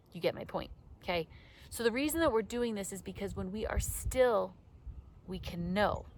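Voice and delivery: ordinary delivery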